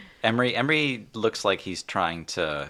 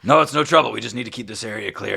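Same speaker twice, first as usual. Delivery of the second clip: raised, hurried official voice; brisk marshal; 5 semitones higher, 8 dB louder, faster than usual. Acted. Half-orc reassuring the group in his way, brisk call. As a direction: start loud, then ease off briskly.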